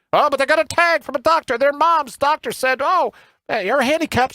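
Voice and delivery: high, sneering mock voice; a lightly put-on mocking voice, pitched well up; mocking quote. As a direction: sneer it out like a pushover doctor. Sarcastic quote mocking a doctor's note.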